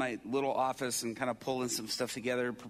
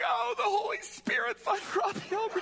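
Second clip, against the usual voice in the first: very high, loud mock-ecstatic worshippers; swooning parody of congregation praise; overjoyed churchgoers; 4 dB louder than usual. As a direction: wail in exaggerated spiritual rapture. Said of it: "people are in the back of the church going".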